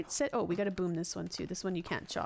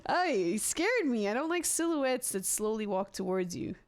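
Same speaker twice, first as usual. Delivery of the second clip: ordinary delivery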